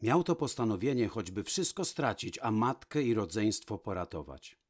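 usual speaking voice

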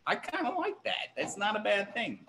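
high, light, soft aside; little high-pitched approving murmur; playful aside. Real, not acted. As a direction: lift it up high and soft, like a cheeky confession. Playful aside on cheap price.

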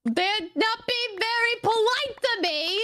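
slow, high, mock-wounded New Yorker; mock New York accent. Friend complaining about rude dogs, mock New York accent. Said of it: "as a New Yorker, I feel offended".

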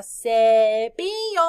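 "cepillo" slow and loud, CE held; stress on first syllable. Shown as a demonstration. Teacher points class to the first syllable, long ce in "cepillo". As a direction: stretch out the ce of "cepillo". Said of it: "Aquí en la primera sílaba".

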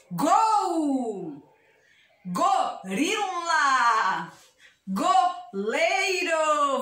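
very drawn-out words, syllable by syllable; slow syllable demo. Shown as a demonstration. Teacher giving children words with go, very drawn out. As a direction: stretch each word out for beginners.

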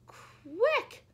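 sharp, high, loud cry; urgent yelp to get moving; shouted. A narrator's reading. Monkeys urging each other to hide, shouting high. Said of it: "shouted the monkeys".

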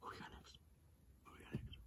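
barely audible whispered question. Real, not acted. Deflated fan asks who is next, whispering. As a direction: whisper it, drained, to yourself.

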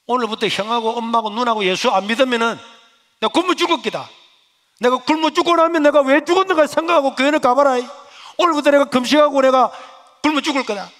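loud, high declaration of a fast; a son vowing to starve until the family believes; defiant vow. Retold or imitated. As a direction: announce the hunger strike with full force. Teen threatens hunger strike.

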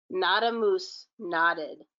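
ordinary delivery

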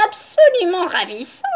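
high, gushing granny; high-pitched affected rapture; feigned delight. A narrator's reading. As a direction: swoon over it with fake admiration. Grandmother joins the feigned delight.

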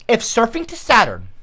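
ordinary talk

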